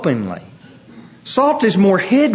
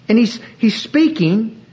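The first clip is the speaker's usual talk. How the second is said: usual speaking voice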